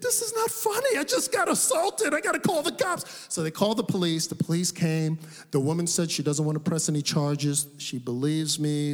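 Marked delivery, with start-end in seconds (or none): 0.0-3.0 high, exasperated protest; indignant protest. Retold or imitated. Assaulted minister protests to laughing hosts, high and exasperated. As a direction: squeak out the outrage. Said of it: "I think".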